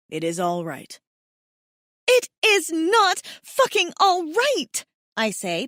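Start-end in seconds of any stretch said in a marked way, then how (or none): 0.1-1.0 low, gentle reassurance from the male mate; soothing. A narrator's reading. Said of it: "Pashov soothes again". 2.1-4.9 high, loud and slow, each word hammered; furious. A narrator's reading. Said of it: "punctuating my words with slaps".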